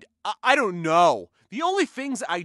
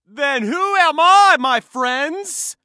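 loud mock outrage; 5 semitones higher, 9 dB louder, slower than usual.

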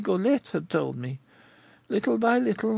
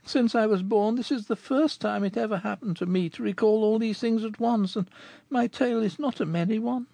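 put-on high girl voice, a touch of sorrow; sorrowfully; 12 semitones higher than usual. Fleur de Marie answers Rodolphe with mild sorrow. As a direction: sigh it out sadly, understated. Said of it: "replied Fleur de Marie sorrowfully".

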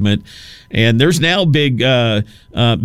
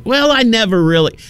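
high-pitched, wavering excuse; hedging tone; 10 semitones higher than usual. Acted. Host mimicking guys with relationship problems, hedging. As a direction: stall in a much higher voice. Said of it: "starts out with that tone".

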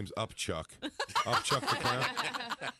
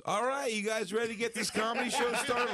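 hyped emcee; 12 semitones higher than usual. Retold or imitated. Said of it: "he goes".

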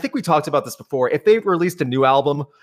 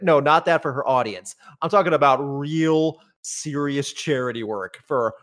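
normal talking voice

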